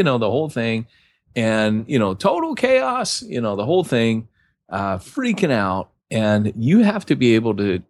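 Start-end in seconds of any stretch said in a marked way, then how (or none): none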